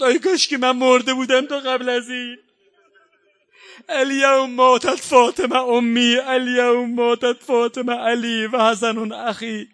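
wailed elegy in full voice; mourning lament. Acted. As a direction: cry it out like a mourner at a majlis. Maddah's rowzeh lament, chanted and wailed.

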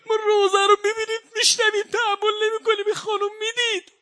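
high, loud, sobbing wail; shouted lament breaking into sobs; weeping shout. Real, not acted. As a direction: cry out through real tears.

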